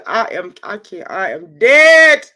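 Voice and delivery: slow, loud, stretched through laughter; dying of laughter; wailed out mid-laugh. Real, not acted.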